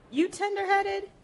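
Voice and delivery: high, teasing question; questioning elder. Acted. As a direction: ask it like a woman about to comb hair. Voicing the hair-fixing question.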